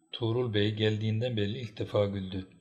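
plain reading voice

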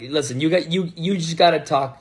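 ordinary delivery

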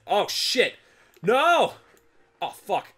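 loud, high panicked stammering. Real, not acted. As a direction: scramble in real panic. Game character falls, panic.

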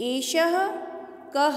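"एषः कः" as e-sha-ha, ka-ha; visarga sounded. Shown as a demonstration. Teacher reads the first line for students, visarga clearly sounded. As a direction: give each word of "एषः कः" its ha ending. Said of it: "दो dot विसर्ग का है sound आई ना".

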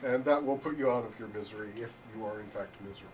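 usual speaking voice

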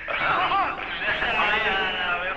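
laughing. Real, not acted. Laughing remark.

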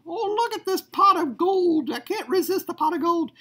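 high, laughing leprechaun voice. Acted. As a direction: play the greedy leprechaun up high. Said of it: "my leprechaun is gonna come in".

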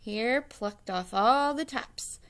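ordinary delivery, nothing marked

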